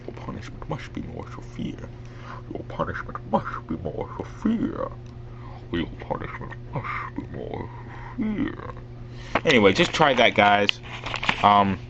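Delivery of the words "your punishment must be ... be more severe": hushed, whispered masked villain; Bane. Shown as a demonstration. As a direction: practise a villain threat in a soft whisper. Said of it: "not to use the higher parts".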